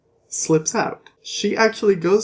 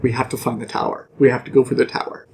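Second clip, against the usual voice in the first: Roland; 5 semitones lower, much faster than usual. Retold or imitated. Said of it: "Roland's like".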